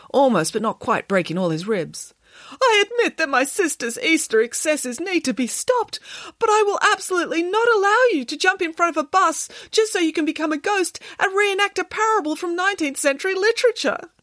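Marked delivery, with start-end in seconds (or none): 2.6-14.1 very high, wailing protest; distraught Boris the bear. Acted. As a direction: wail it in a long, anguished rush of words. Boris emotionally forbids Derek's plan.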